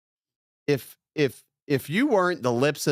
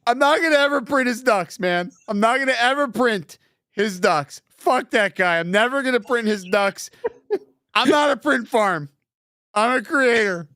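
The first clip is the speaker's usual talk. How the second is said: mock rant; 7 semitones higher than usual. Mock rant about printing ducks.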